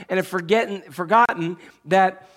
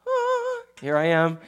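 high, sing-song "Ah" popping in cheerfully; playful Jesus popping in; 8 semitones higher, slower than usual. Retold or imitated. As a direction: surprise them with a bright hello. Jesus suddenly appears, playful.

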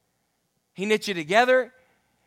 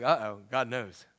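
worried uh-oh; 10 semitones lower, 6 dB quieter than usual. Retold or imitated. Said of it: "But then I'm like".

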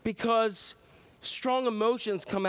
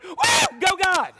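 very high, loud whoop; army whooping at God; whooping cheer; 9 semitones higher, 9 dB louder, slower than usual. Retold or imitated. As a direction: whoop like a crowd.

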